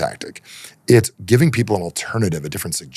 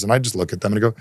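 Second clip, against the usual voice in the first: normal talking voice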